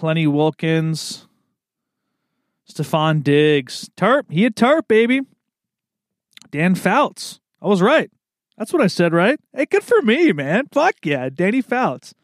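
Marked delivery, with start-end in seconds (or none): 4.0-12.1 high, gleeful self-congratulation; excited. Real, not acted. Cheering himself after guessing Dan Fouts right, high and excited. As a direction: crow about the win, voice jumping up.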